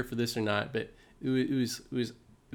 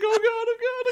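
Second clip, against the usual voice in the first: mock panicked squeal, much higher; scared squeal; 3 dB louder, faster than usual. Friend with a centipede on him, high panicked squeal. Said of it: "like, scared".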